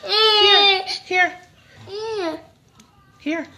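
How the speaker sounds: high, loud coaxing coo. Real, not acted. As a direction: mom offering food to a crying twin. Coaxing crying toddler.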